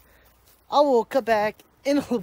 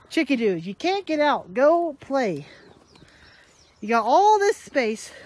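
talking to pets; 5 semitones higher, 4 dB louder, slower than usual. Real, not acted. Talking to his chickens.

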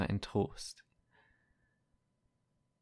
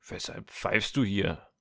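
guard's bellow; 4 dB louder than usual. Acted. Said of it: "brüllte er".

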